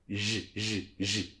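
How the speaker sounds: soft "J" like jazz without the D, very quiet; French J sound. Shown as a demonstration. Teacher demonstrating the French J to learners. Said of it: "Donc ça fait comme ça".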